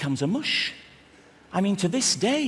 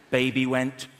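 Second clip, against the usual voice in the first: ordinary talk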